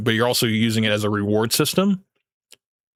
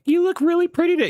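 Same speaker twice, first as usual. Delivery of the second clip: no-bass simp voice; faster than usual. Shown as a demonstration. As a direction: squeak it out like a timid simp. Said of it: "but you sound like this".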